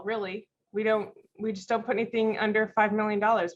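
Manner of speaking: ordinary talk, nothing marked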